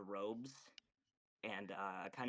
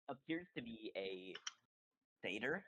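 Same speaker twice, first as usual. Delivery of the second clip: ordinary delivery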